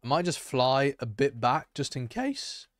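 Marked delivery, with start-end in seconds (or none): 1.8-2.7 accidental squeak on the last word; voice crack. Real, not acted. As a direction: voice breaking upward on "case". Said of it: "Ignore the voice crack".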